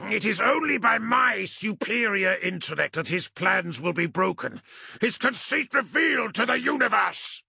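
high, strained furious roar; bellowed boast, pitched far up. A narrator's reading. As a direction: roar it with grandiose fury. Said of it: "he roared".

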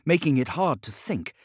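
ordinary delivery, nothing marked

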